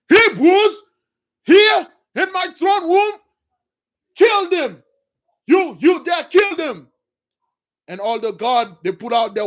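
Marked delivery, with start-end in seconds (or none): 0.1-6.9 much higher, loud shouted orders; outraged king's command. Retold or imitated. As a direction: bark the kill order across the throne room. Pharaoh orders killing.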